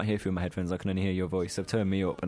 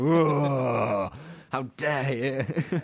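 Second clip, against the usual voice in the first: higher, drawn-out mock indignation; mock outrage; 4 semitones higher, 4 dB louder, much slower than usual. Imitating co-host shocked by one swear, mock outrage. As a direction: gasp it out slowly in exaggerated offence. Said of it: "you go".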